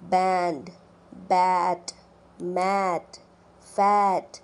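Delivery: बैंड, बैट, मैट, फैट spoken low and distinct; teacher reads model words; ae vowel demo. Shown as a demonstration. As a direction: model each word in isolation. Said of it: "ae vowel word list".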